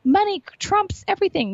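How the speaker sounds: high mocking voice of doubters; mock skeptics. Guest mocking people who say money trumps all, high mock voice. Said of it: "I know people like".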